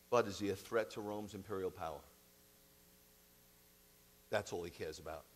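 ordinary talk, nothing marked

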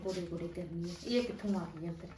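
low, soft grumble under her breath; muttered aside. Acted. Mother grumbling aside about the aunt, low muttered complaint. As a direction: mutter it so the guest can't hear.

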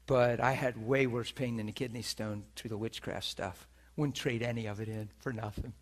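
usual speaking voice

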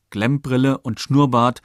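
usual speaking voice

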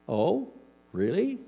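skeptical oh really. Acted. Skeptical reply.

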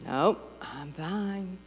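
slow, soft, clipped refusal; a mild stoic mother; curt martyr mom. Retold or imitated. Mother voiced in story.

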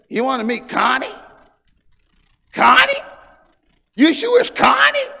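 high, loud mocking brother voice; teasing brother. Preacher imitating the teasing brother, loud and high. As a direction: tease him in a squeaky singsong. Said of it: "he said it like this".

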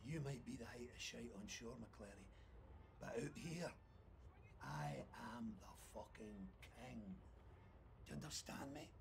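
low, quiet threat; quiet menace. Acted. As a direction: drop to a dangerous hush.

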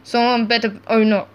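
ordinary talk

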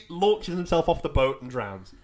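ordinary talk, nothing marked

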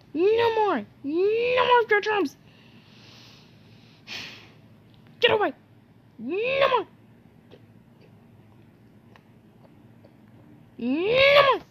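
high mock yelling. Fending off the ship.

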